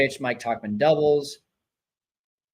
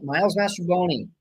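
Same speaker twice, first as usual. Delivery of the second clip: normal talking voice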